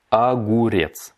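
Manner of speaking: огурец said slowly and clearly; slow teaching repeat. Shown as a demonstration. Teacher slowing огурец down for the class. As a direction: stretch огурец out. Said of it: "slow repeat of vocabulary".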